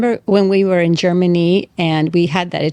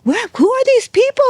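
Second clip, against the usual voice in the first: amazed waiter voice. Retold or imitated. Waiters amazed at diverse group.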